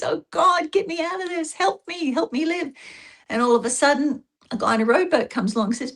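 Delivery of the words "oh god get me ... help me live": high, begging plea to God; pleading prayer. Retold or imitated. Stranded man in the flood begging God, pleading. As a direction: mock-desperate prayer from the rooftop. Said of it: "and he prays".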